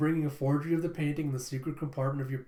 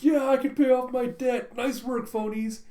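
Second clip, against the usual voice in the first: much higher, louder gleeful cheer; 10 semitones higher, 5 dB louder than usual. Acted. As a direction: cheer like all your money problems just vanished. A loser celebrates paying his debt.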